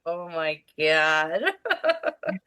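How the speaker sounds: low, drawn-out exasperation; exasperated groan. Retold or imitated. Caregiver gives up on the joking boy, low and drawn out. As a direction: sigh it out, totally done. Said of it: "I'm like".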